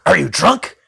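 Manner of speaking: loud, high imitation of an angry guy; mock-indignant shouted question. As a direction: demand it loudly, mocking his anger. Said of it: "he got really angry at me".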